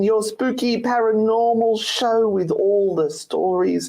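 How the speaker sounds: slow, drawn-out radio welcome; show intro. Acted. Host opening the spooky radio show, slow announcer voice.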